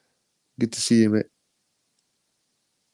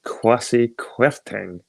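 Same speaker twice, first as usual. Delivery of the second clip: drawn-out, disbelieving name; slow scornful naming; much slower than usual. Real, not acted. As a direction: spell the name out slowly in disdain. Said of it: "who the fuck is this guy?".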